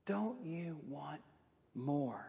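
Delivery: soft, slow, sly temptation; quiet slow hissing tempter; serpent voice. Acted. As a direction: lean in and tempt them quietly.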